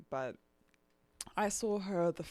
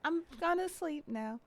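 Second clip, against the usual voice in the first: high, little voice for the dog, lightly louder; sleepy puppy voice; 12 semitones higher, 4 dB louder than usual. Retold or imitated. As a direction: play the puppy announcing bedtime. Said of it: "and now she's just like".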